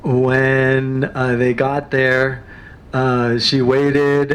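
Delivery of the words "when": ordinary talk, nothing marked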